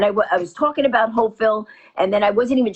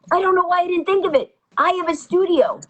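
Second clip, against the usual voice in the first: excited quote; 6 semitones higher than usual. Retold or imitated. Guy's excited realization retold.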